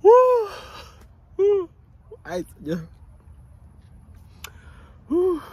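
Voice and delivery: very high loud hoot; hammed-up wild hoots; excited hoot. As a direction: hoot wildly. Mock cries after counting.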